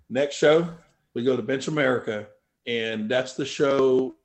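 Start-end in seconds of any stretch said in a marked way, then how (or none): none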